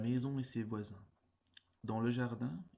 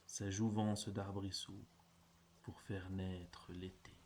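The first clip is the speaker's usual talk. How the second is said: ordinary talk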